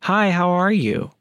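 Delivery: high, drawn-out phony hello; fake sweet greeting. Neighbor answers the door to an officer, fake and angelic. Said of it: "put on this fake".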